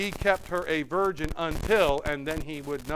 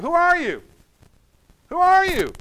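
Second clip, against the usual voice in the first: alarmed question; 13 semitones higher, 10 dB louder than usual. Acted. Jack asks, startled.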